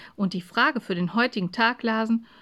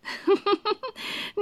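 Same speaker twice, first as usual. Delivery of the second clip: high-pitched, contained giggle; amused little titter; 12 semitones higher than usual. Acted. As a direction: giggle to yourself before answering. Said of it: "die kichern musste".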